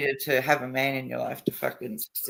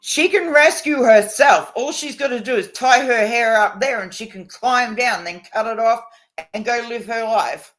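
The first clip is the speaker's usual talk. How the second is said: a hint of a bold kid, higher and louder; defiant child; 8 semitones higher, 7 dB louder than usual. Retelling her eldest mocking the Rapunzel play, lightly voiced defiant child. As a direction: voice the eldest daughter setting the teacher straight, a touch cocky. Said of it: "and goes".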